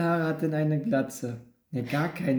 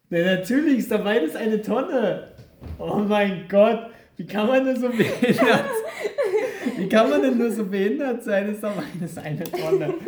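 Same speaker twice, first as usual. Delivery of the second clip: louder, laughing disbelief; laughing exasperation; 6 semitones higher, 4 dB louder than usual. Real, not acted. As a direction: laugh at yourself, loud and incredulous. Exasperated at own mistake, laughing.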